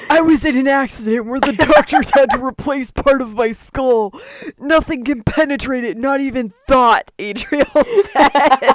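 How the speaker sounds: fighting back laughs; laughing while reading. Real, not acted. Reading through a fit of laughter.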